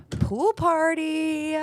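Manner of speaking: high, slow airhead voice; drawn-out ditzy cheer; ditzy party girl. Acted. Ditzy girls' dumb cheer.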